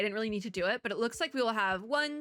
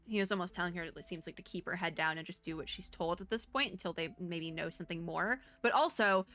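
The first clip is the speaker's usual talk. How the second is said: ordinary talk, nothing marked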